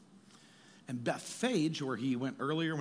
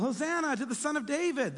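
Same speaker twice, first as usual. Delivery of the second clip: put-on children's cry; 10 semitones higher, 3 dB louder than usual. Children crying out in temple.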